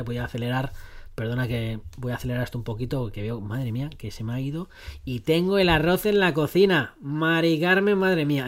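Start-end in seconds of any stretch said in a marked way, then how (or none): 5.0-8.5 llamando fuerte hacia la cocina, más agudo; llamada en voz alta. Real, not acted. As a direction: alzar la voz para avisar a Mari Carmen. Grita a Mari Carmen por el arroz. (llamando en voz alta a Mari Carmen por el arroz)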